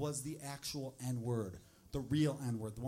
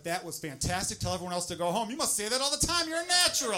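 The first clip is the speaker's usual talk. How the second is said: rising ever higher and louder into a gushing rave; mock casting gush; 4 semitones higher, 7 dB louder than usual. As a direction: gush like a thrilled casting director. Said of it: "casting people to be like".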